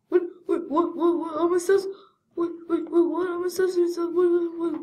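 high, loud pretend panic; frantic confusion. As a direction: stammer it out high and bewildered.

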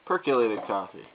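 ordinary talk

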